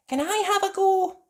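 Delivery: very high polite ask; high cat lady voice. A narrator's reading. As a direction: ask sweetly. Missus Cat asks.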